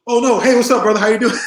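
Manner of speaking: loud, high-pitched retold hello; enthusiastic greeting. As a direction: imitate sudden delighted recognition, big and loud. Acted warmer greeting after proper introduction.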